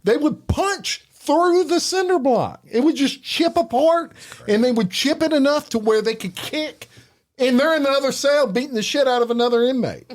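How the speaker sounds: excited retelling. Real, not acted. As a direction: pitch jumps way up, eager and wide-eyed. Excited retelling of prison story.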